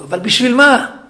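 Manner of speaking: put-on teacher raising his voice at a child. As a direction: raise the voice on the question. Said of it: "קצת הרמתי את הקול".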